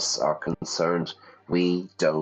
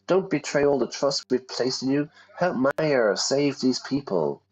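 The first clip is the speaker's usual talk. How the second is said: pleading; 7 semitones higher than usual. Acted. Voicing pleading character.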